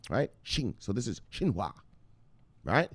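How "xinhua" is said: "Xinhua" said "shin-hwa". Shown as a demonstration. Teaching viewers the newspaper's name, "Xinhua" as shin-hwa. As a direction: open "Xinhua" on a sh sound. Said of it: "That's how you pronounce an X".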